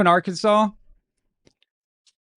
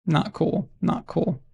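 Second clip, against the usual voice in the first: mock hurt; 4 dB quieter, slower than usual. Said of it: "I'm devastated".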